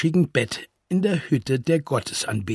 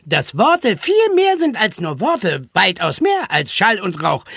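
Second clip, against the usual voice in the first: animated, self-important voice with leaping pitch; boastful chatterbox; 6 semitones higher than usual. Acted. Bodiless voice boasts to friends of its greatness, excitedly. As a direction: rattle on grandly, giggling at your own greatness. Charlie Gottchen's boastful monologue.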